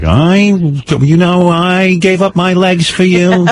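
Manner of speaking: guilt-tripping mother, slow and high; milk the sacrifice like a martyr mom; martyr mom impression. Son mocks his martyr mother, slow high guilt-trip voice. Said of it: "She'd be like".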